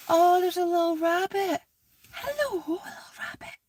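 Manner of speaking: soft, high-pitched coo at a tiny rabbit; cooing to animal. Real, not acted. Woman spots a little rabbit, high soft cooing. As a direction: coo sweetly high and softly.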